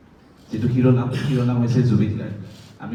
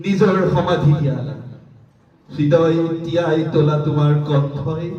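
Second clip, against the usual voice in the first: poem recitation; 7 semitones higher, slower than usual. Acted. Reciting a tribute poem for the late singer at a book launch, elegiac. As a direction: mourn him line by line, as if speaking to him.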